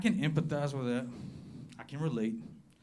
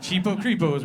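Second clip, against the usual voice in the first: laughing punchline; 5 semitones higher, 7 dB louder than usual. Real, not acted. Punchline repeated for effect.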